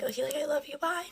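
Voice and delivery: soft, high, sweet goodbye; lightly mocking lovey farewell; imitating a girl. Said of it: "one of the girls is like".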